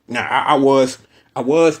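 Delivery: normal talking voice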